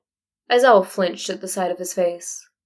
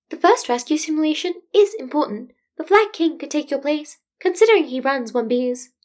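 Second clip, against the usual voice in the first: higher, polite and measured small creature; a careful, tactful principal; diplomatic Nezu; 7 semitones higher than usual. Acted. Nezu's voice held by narrator.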